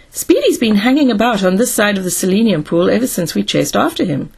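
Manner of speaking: ordinary delivery, nothing marked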